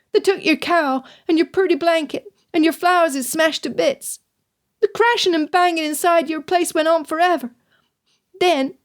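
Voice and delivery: put-on a scared child reporting, words rushing; frightened boy. A narrator's reading. Jonah describing the raid to Madame, high frightened boy.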